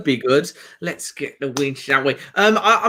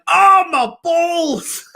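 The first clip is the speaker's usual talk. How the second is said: slow, much higher, laughing yelp of pain; comic pained yelp; 13 semitones higher, 4 dB louder, much slower than usual. Retold or imitated. Retelling his bungee mishap, laughing high yelp. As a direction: re-enact the yelp of getting hit in the groin, laughing. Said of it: "It sounded like, you know, the guy of Austin Powers".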